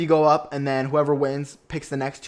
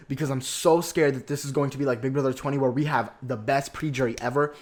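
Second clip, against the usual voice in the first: ordinary talk, nothing marked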